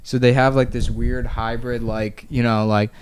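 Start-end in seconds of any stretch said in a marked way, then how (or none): none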